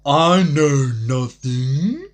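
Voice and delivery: slow drawn out. Real, not acted. Drawing out the claim of knowing nothing. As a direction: stretch it out dramatically. Stretched dramatic line.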